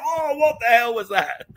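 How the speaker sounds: high, loud retold shock, stretched out; startled outcry. Streamer re-enacting his shock at the explosion, startled outcry. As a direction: re-enact a stunned shout.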